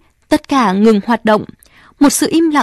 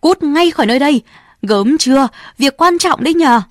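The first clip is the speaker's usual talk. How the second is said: angry outburst; 4 semitones higher than usual. Acted. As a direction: snap at the crowd to clear off. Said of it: "bây giờ nổi cáu". (quát giận dữ, nhanh)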